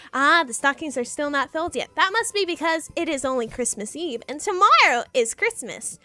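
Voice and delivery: ordinary delivery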